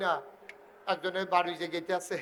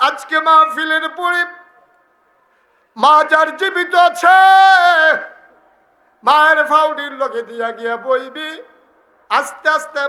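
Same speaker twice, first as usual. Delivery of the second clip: high, loud sermon shout; full-force preaching; 10 semitones higher, 16 dB louder than usual. Real, not acted. Preacher urging sons to sit at their mothers' feet, shouted exhortation. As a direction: cry it out to the crowd, much higher and louder.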